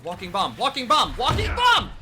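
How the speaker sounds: building from plain to a high, loud yell. Real, not acted. Streamer cheering a spell in combat, building to a yell. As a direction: chant it, rising to a real shout. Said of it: "I'm sorry, I'm yelling".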